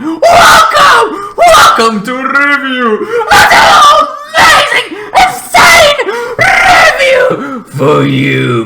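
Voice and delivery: very high, very loud screaming welcome, slowed on the long words; hammed-up shrieking intro; hyped welcome shout. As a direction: scream the welcome like an overexcited showman.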